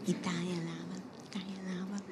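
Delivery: soft, hushed Italian accent. Shown as a demonstration. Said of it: "have to say it like that".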